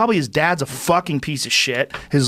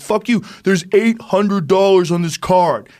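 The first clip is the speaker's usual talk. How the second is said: angry yell; 5 semitones higher, 3 dB louder than usual. Retold or imitated. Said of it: "He's like".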